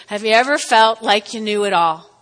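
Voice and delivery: ordinary talk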